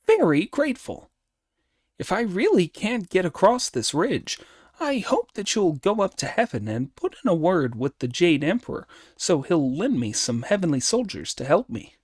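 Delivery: high, lively monkey hero making a request; high-pitched, eager appeal; Monkey, grateful. Acted. Monkey asking the planet for heavenly soldiers, grateful.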